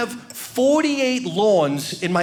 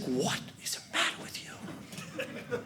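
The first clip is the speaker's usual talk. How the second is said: softer, slow, almost whispered; hushed disbelief; 4 semitones lower, 15 dB quieter, slower than usual. Retold or imitated. Wife catching him dancing with the dog, hushed disbelief. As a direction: ask it under your breath, baffled. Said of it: "She's like".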